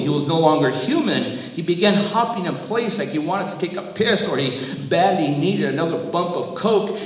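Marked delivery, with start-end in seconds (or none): none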